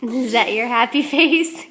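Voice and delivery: delighted giggly question; laughing. Real, not acted. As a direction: laugh while you ask it. Laughing at toddler's face.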